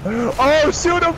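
high, sharp urgent call; urgent callout. Real, not acted. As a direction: yell the warning mid-fight. Calling out during a firefight.

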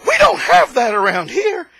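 high, loud mock gasp of a scandalised small-town lady; shocked lady. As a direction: ham up her horrified clutch-the-pearls reaction.

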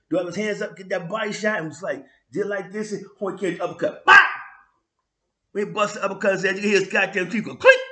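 usual speaking voice

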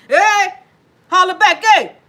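high, loud hype shout; excited shout. Real, not acted. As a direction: whoop it out like a call. Hyped shout to viewers.

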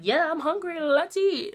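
high, loud mock-baby enthusiasm; eager hungry baby. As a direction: voice a keen little eater. Voices hungry baby daughter eagerly.

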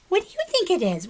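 high, quick teasing pigeon; pigeon asking. Acted. Pigeon quizzing the children, high and quick. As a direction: pigeon fishing for a guess.

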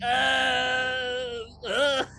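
high, panicked mock cry; mock groan. Fulci attacked by crows, high panicked mock cries. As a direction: yelp like he's under attack. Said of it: "he's like".

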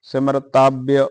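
ਸਿਮਰਤਾਬਿ said alone, each syllable clear, simrit-aabi; ਸਿਮਰਤਾਬਿ as a careful model word; pronunciation demo. Shown as a demonstration. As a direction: isolate ਸਿਮਰਤਾਬਿ and sound it out syllable by syllable. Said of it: "ਇਹਦਾ ਉਚਾਰਨ ਕਰਨਾ ਹੈ।".

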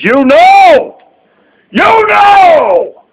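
high, loud angry yell; furious shouted taunt; real shout. Real, not acted. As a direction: blast it at him. Ranting at a rival streamer.

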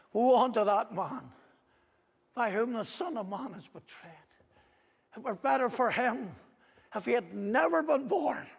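loud, high prophetic warning; thundering judgment. Acted. Preacher pronouncing woe on the betrayer, loud and high. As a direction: ring it out like a curse.

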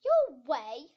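loud, indignant bellow. A narrator's reading. Queen challenging Alice about finding her way, bellowing. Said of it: "bellowed the Queen".

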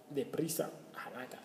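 ordinary talk

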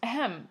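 pointed throat clear and ahem; small acted "ahem". A narrator's reading. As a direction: clear your throat for attention. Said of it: "Janine cleared her throat".

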